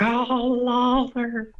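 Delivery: high, loud freaking-out yell; mock scream. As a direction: screech like a panicked wrestler. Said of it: "Terry Funk screaming".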